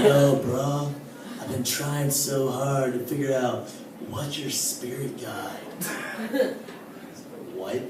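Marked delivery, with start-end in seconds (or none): 0.0-6.6 lightly voiced stoner buddy, slow and softer; spacey stoner friend. Spiritual friend reviewing his jokes, a hint of a slow, soft stoner drawl. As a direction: drift through it like a burnt-out stoner, softer. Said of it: "he does talk like this".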